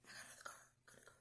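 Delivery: whispered joy; hushed repeated whisper; breathless whispered disbelief. Retold or imitated. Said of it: "I'm like".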